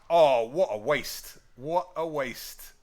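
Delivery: slow, drawn-out mock dismay; mock lament. Player lamenting a wasted attack, slow and drawn out. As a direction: stretch each word in hammed-up regret.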